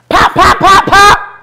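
loud laughing pop pop gunfire imitation; gunshot sounds. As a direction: mimic the shots with a laugh.

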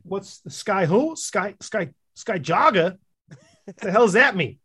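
Sky Jogger? what does that even mean, half yelled; baffled outrage. Real, not acted. Friend mocking a Star Wars name, with baffled outrage.

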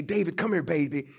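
lightly voiced Southern elder, coaxing; great-grandmother calling. Great-grandmother to little David, an affectionate Southern call. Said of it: "great-grandmother used to say".

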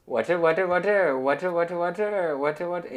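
high imitated Indian-accented vendor chant. Man correcting his water seller impression, high Indian-accented call. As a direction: loop the street call in a put-on Indian accent. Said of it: "pero con un acento indio".

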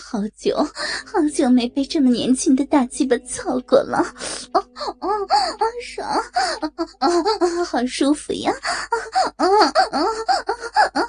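breathy moans between broken phrases; moaning with pleasure. A narrator's reading. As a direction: gasp and moan through the words. Character moaning mid-encounter. (呻吟着、断断续续地说)

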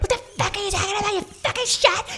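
high, put-on outraged woman's voice; a woman snapping at a guy who groped her; mock angry girl. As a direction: play an indignant woman telling him off. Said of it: "and girls like".

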